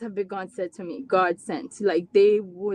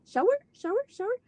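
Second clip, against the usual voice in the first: put-on high, sing-song repeated word like an excited child; imitating her little son; 12 semitones higher, faster than usual. Mom mimics her son asking for a shower, high childlike voice. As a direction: chant it the way a small nonverbal boy would, high up. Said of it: "And he'd be like".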